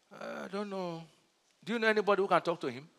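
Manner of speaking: very soft, timid mock plea; imitated helplessness. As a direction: mimic someone meekly giving up and seeking help. Acting a faithless job seeker.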